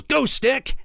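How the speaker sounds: high, sharp shout; battle cry. Acted. Little Viking springing on the innkeeper, a high battle shout. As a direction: leap up and unleash the stick.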